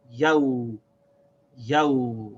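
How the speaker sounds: yaw as one long gliding syllable; connected sounds. Shown as a demonstration. Teacher connects waw to ya, yaw as one long syllable. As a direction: glide ya into a drawn-out w on each yaw. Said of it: "connect the sound of the waw to this ya".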